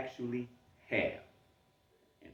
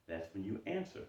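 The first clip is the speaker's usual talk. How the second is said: ordinary delivery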